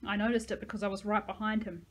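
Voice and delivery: plain reading voice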